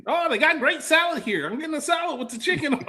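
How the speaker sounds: mocking his friend. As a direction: camp it up as your buddy ordering salad. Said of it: "that's your voice".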